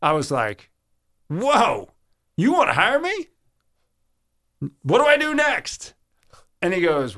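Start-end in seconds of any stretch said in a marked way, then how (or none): 1.3-5.9 high, excited disbelief at the job offer; ecstatic. Retold or imitated. Young sportscaster hearing he got the job, ecstatic. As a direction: gush with stunned joy, pitched way up. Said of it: "And I was so ecstatic".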